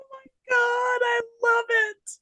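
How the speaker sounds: very high, breaking out of real laughter; delighted laughing squeal. Real, not acted. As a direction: squeal with delight after cracking up. Delighted after long laugh at joke.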